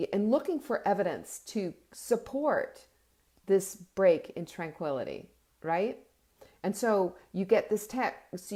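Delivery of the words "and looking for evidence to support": usual speaking voice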